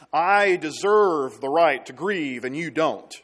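loud, slow, indignant demand; self-pitying claim. Retold or imitated. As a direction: demand your right to grieve. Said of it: "and say".

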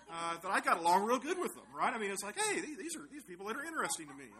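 loud, high, delighted retelling; excited. Real, not acted. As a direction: burst out with delight at finding fellow nerds. Enthusiastic retelling of finding nerds.

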